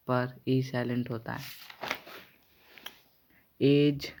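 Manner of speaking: normal talking voice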